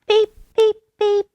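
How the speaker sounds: tiny high beep beep calls; high peeping. A narrator's reading. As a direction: chirp it like a tiny frog. Peeping frog heard from afar.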